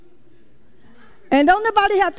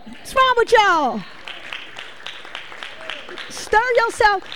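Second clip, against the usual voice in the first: country coming out; 5 semitones higher, 3 dB quieter than usual. Real, not acted. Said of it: "y'all making my country come out of me".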